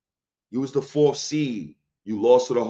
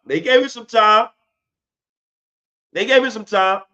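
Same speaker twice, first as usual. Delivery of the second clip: sarcastic; 8 semitones higher, 6 dB louder than usual. Real, not acted. Sarcastic punchline repeated.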